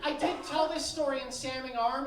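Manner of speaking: ordinary talk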